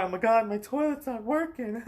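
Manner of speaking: high, loud, whiny panic over a toilet; mock whiny cry. Mocking how others would whine over a broken toilet, high and loud. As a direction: ham up a helpless damsel moaning. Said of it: "I'm not gonna cry".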